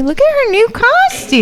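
high-pitched, thrilled reaction; excited exclamation. Real, not acted. Viewer gushing to a friend about a costume, excited. Delighted reaction to outfit on screen.

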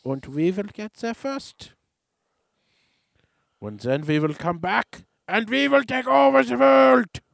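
a cartoon German villain declaring conquest; mock German accent building to a shout. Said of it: "sounded like this".

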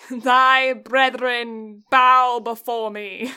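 loud, slow archaic decree; dark lord. Pretend. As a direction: boom like a fantasy overlord. Grand fantasy larp proclamation.

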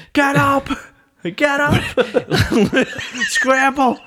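high-pitched urgent cornering through laughter; mock cornerman yell. Coach yelling to his downed fighter, mock-panicked.

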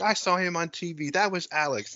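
high-pitched insistent wife; a hint of hysterical wife; mildly acted hysteria. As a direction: insist frantically, without really screaming. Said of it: "hysterical 'cause she's like".

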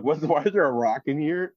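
high, incredulous mock question; retold bewilderment. Partner asking about a rock in bed, mock bewilderment.

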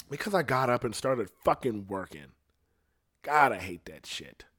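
usual speaking voice

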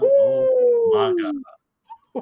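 high, loud whoop of amazement; real awed exclamation. Real, not acted. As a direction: cry out high in delighted disbelief. Co-host marvels at the passes.

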